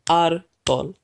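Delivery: Árbol split into two separate beats, AR, BOL; clap-along Árbol, one syllable per beat. Shown as a demonstration. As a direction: break Árbol into AR, pause, BOL. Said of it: "we can see that there are two syllables".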